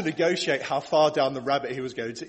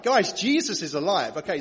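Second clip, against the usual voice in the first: ordinary delivery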